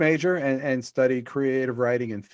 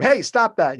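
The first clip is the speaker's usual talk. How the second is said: higher, louder shout after someone; retold yell; 9 semitones higher, 7 dB louder than usual. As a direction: re-enact yelling at a fleeing thief. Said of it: "we started yelling at him".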